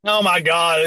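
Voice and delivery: normal talking voice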